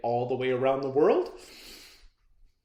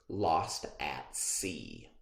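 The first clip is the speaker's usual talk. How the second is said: dramatic title; 9 semitones lower, 8 dB quieter, much slower than usual. Acted. Dramatic reading of story title.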